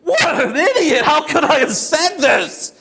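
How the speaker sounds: high-pitched, hammed-up wail at himself; self-reproach. Disciple berating himself at the sight of the storm, self-reproach. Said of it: "I'm looking at this storm and I'm thinking".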